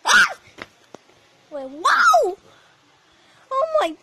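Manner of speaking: high, loud startled cries; startled screaming. Real, not acted. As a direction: shriek in surprise.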